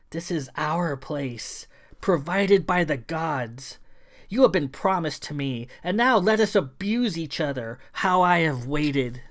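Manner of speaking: menacing creature. Acted. Creature woman beckons hero to fight.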